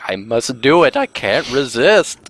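drawn-out melodramatic surrender, rising at the end; mock helpless compulsion. Player mocking his friend's urge to draw, hammed-up compulsion. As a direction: act overpowered by an irresistible urge. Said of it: "His hand just starts lurching".

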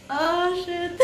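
tearful high gasp; choking up on tears; crying. Real, not acted. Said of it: "I'm crying".

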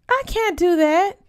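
startled outburst, sitting bolt upright; alarmed protest. Acted. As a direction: refuse in a rush of alarm. Sat up in my chair.

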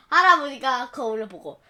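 usual speaking voice

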